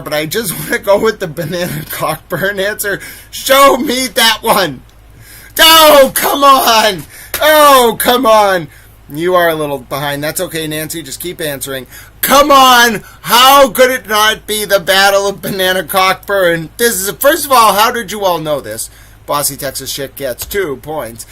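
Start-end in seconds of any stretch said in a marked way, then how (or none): 3.3-8.7 loud, drawn-out protest, pitched high; hammed-up "oh, come on" outburst; mock dismay. Mock outrage at losing answer. 12.2-18.9 loud, high-pitched protest, easing slightly; hammed-up indignation at a lost quiz point; mock outrage. Mock outrage at losing answer.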